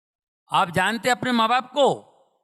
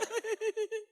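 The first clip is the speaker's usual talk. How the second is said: high, soft whimpering sob, acted; mock crying; 14 semitones higher, 12 dB quieter than usual. Parent hurt by the child's reply, mock weeping. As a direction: put on a squeaky little weep. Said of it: "फिर अपन रोते".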